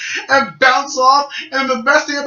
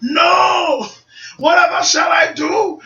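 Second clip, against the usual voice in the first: high theatrical cry; hammed-up villain panic; mock dismay; 8 semitones higher, slower than usual. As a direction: wail in exaggerated despair. Acting villain's alarm at attack.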